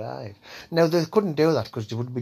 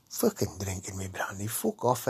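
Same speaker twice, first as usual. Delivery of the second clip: ordinary talk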